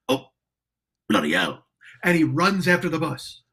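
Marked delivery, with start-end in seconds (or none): 0.1-1.6 British "Oh, bloody hell" with a lower, louder exasperated tone; British exclamation, say "bloody hell" like a Brit left behind; a British keyboard player chasing the bus, exasperated; English accent. Retold or imitated. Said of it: "he's an English dude ... he goes".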